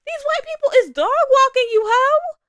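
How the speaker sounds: hammed-up lecture to a friend; mock scolding friend. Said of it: "we just like".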